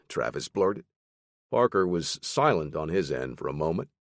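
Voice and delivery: usual speaking voice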